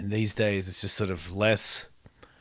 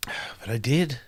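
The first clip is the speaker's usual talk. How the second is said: a soft, breathy whisper of regret; whispered admission; 3 dB quieter, slower than usual. Real, not acted. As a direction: let it slip out as a whispered confession. Admits hurting someone's feelings.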